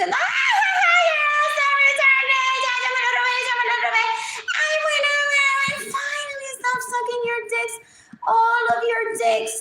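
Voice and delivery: very high laughing squeal of triumph; mocking Gaby. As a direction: squeal it high like she's gloating over the theft. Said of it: "Gaby se está riendo y dice". (imitación aguda y burlona de Gaby, gritando)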